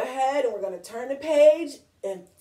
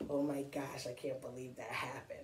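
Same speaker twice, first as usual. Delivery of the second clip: embarrassed; 10 semitones lower, 15 dB quieter than usual. Retold or imitated. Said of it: "He's kinda like".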